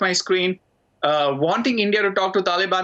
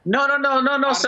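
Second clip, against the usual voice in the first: raised protest; 6 semitones higher than usual. Real, not acted. Heated debate, interrupting the host.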